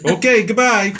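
high, loud, sarcastic goodbye; mock dismissal. Teasing a woman at his demo, loud mock goodbye. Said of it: "I said".

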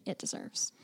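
reverent whisper. Real, not acted. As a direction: breathe the last words out.

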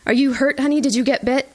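put-on mom frantically checking her child; worried mother. Mother checking daughter for bites.

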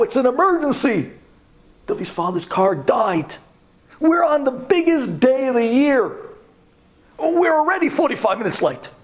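put-on a boy yelling for his father to come right now; urgent phone plea. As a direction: shout down the payphone to dad. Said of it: "this guy tells his father".

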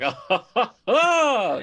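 high, loud mock "oh" with laughter; imitated exclaimed ohs. Host imitates Roy's reaction, exaggerated laughing ohs. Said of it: "And Roy's like".

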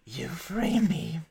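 a silky, bargaining fiend, lightly voiced; a chained devil bargaining with the party, silky; smooth devil. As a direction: sell the deal like a charming devil.

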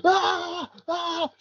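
high, imitated cries of pain; mock high-pitched pained cries; imitated scream. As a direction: yelp it like the mate in agony.

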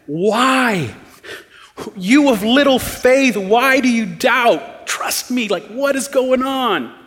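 high-pitched, drawn-out appeal; exasperated pleading. Acted. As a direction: plead with him in slow, high disbelief. Acts out an exasperated, pleading Jesus.

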